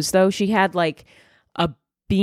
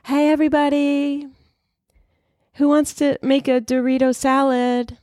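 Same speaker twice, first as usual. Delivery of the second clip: high-pitched chirpy sing-out; sweet, squeaky held-high voice; Elizabeth Holmes; 8 semitones higher than usual. Acted. Inmate chilling in jail offering to make food, high chirpy voice. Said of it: "the reverse Elizabeth Holmes".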